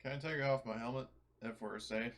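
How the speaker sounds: ordinary delivery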